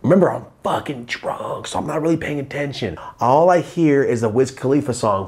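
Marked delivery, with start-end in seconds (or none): none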